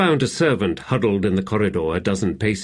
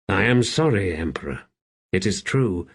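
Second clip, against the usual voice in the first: usual speaking voice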